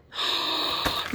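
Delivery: long, breathy gasp of surprise; sharp gasp. Real, not acted. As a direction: gasp at a thrilling find. Unboxing a surprise item.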